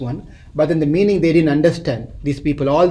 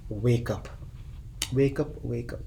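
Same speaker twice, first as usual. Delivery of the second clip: lower, much softer call to wake; soft urging; 4 semitones lower, 12 dB quieter than usual. Acted. Teacher gently urging his listeners to wake up, soft and low. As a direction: coax them awake almost under the breath.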